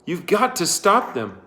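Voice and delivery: high pressing plea; urgent. A narrator's reading. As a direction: urge him on. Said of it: "urged Catherine".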